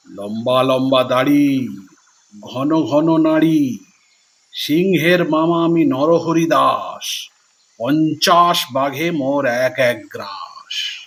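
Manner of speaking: low, slow, booming brag to scare the fox; a little goat posing as a monster; deep boastful monster voice. Acted. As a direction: puff yourself up into a fearsome beast.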